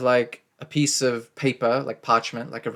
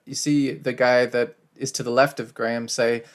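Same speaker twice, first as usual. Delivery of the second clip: usual speaking voice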